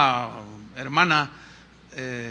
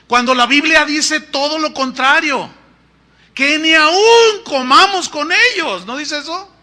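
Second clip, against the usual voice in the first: impassioned preaching; 7 semitones higher, 8 dB louder than usual. Real, not acted. Preacher rebuking idolatry.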